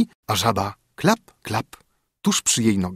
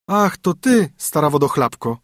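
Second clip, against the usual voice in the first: put-on high, bright young princess; a spoiled princess, pitched up; 5 semitones higher, 4 dB louder than usual. Princess answers the frog.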